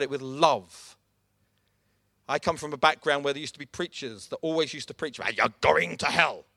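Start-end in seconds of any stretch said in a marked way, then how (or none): 5.4-6.4 mock Belfast hellfire preacher accent. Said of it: "with a Belfast accent".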